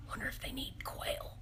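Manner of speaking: a hushed, plotting whisper; scheming whisper. Retold or imitated. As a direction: whisper the idea like a secret plan. Quoting her own scheming thought.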